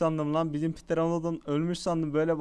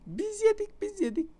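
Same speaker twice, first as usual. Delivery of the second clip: very high-pitched little squeak; tiny squeaky cartoon-like pitch. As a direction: squeak it out way above your own voice. Mimics eating in squeaky voice.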